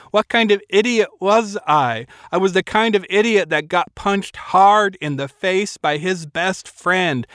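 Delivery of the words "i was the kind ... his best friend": usual speaking voice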